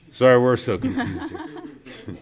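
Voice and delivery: loud, slow, laughing through the words; flustered, amused apology. Real, not acted. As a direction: laugh it off while apologizing for the muddle. Flustered apology amid shared laughter.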